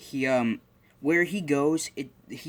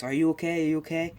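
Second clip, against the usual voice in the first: higher-pitched worried check on someone; concerned; 6 semitones higher, 3 dB louder than usual. Retold or imitated. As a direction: ask with quick, mild concern. Acting concerned helper in story.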